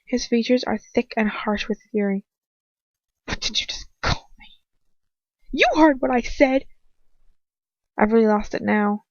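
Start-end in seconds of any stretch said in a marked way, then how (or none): 3.3-4.5 low, dangerous, hushed fury; quiet menace. A narrator's reading. Enraged captor demands what she called him, in quiet menace. Villain, face a mask of rage. 5.5-6.7 louder, higher defiance; defiant shout. A narrator's reading. Girl defies her captor with a shout.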